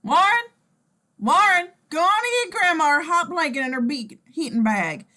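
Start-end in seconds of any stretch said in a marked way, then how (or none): 0.0-0.2 calling out. Acted. Grandma calling out. 1.2-1.7 loud call to grandson. Acted. Grandma calling out.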